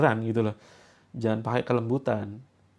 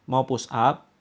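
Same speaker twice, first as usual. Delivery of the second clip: ordinary delivery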